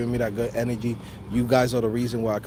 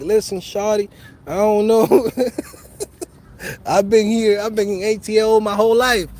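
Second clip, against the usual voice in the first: higher and louder, cocky ATL swagger; Atlanta slang; 8 semitones higher, 5 dB louder than usual. Pretend. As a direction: brush them off like a born Atlanta native. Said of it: "talking a whole different fucking slang".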